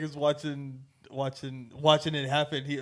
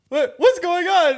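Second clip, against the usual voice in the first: much higher, loud alarmed shout; panicked shout; 12 semitones higher, 8 dB louder than usual. Retold or imitated. Voice actor watches the burial and shouts in alarm. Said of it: "He was like".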